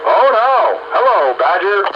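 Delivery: ordinary delivery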